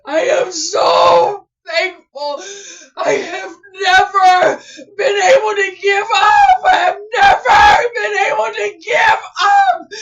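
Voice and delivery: crying it out in relief; sobbing with joy. Real, not acted. Creator sobbing because her store finally ranks on Google, tearful joy.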